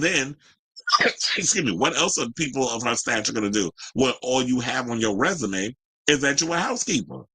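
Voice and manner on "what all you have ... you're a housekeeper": normal talking voice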